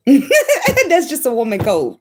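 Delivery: high, slow words through laughter; laughing. Real, not acted. Explaining what women just know, laughing. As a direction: laugh your way into the line. Cracks up at her own remark.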